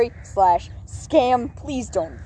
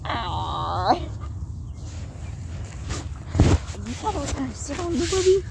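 dog voice; 3 dB quieter than usual. Pretend. Voicing the dog.

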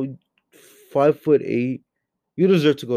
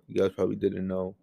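usual speaking voice

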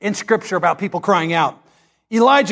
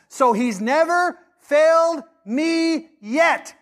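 high, slow, every word weighted; preacher driving home the point; emphatic slow declaration; 8 semitones higher, much slower than usual. Acted. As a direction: lean hard on failed.

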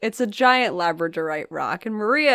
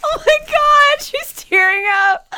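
much higher and louder, slow and laughing; touched, laughing; 8 dB louder, slower than usual. Real, not acted. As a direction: laugh in touched disbelief as she tears up. Friend moved to tears by gift.

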